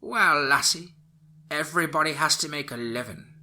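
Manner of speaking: high crone voice, sly and gloating; evil old witch. Acted. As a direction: smirk through it like a wicked hag. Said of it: "replied with an evil smile".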